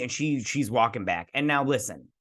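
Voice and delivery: usual speaking voice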